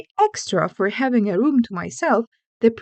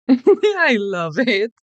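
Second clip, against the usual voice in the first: laughing; 3 dB louder, much slower than usual. Real, not acted.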